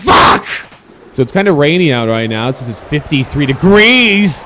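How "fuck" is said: loud, explosive swear; shouted curse. Real, not acted. Player swearing at the game, shouting. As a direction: burst out with it in frustration.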